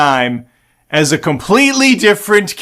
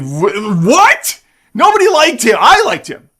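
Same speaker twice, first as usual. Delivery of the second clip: indignant surprise; 5 semitones higher than usual. Real, not acted. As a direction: protest in outraged shock. Protesting that nobody liked Marsden.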